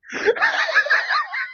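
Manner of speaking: sudden high shriek of laughter; high laughing shout. Real, not acted. Cracking up at his own punchline, high shrieking laugh. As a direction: burst out in a high cackle.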